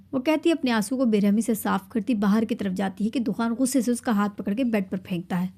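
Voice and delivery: ordinary delivery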